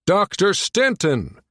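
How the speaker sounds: slow, high, drawn-out call. A narrator's reading. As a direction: call his name across a noisy room, stretched out. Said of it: "called out once more".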